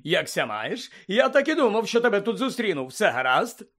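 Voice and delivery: loud, high, effusive welcome; hearty greeting. Acted. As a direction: gush at meeting an acquaintance. Bagman heartily greets Harry.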